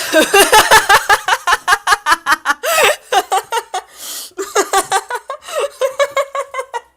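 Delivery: high, loud, long cackle; helpless high-pitched laughter; loud laughing fit. Real, not acted. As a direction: burst out laughing and keep going. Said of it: "I'm laughing at what Star said".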